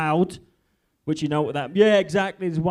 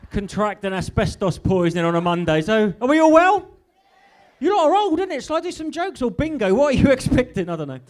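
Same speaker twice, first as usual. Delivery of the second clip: ordinary talk, nothing marked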